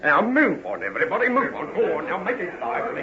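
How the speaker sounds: low London constable voice, taking charge; a policeman sorting out an accident; gruff constable. Acted. Same constable.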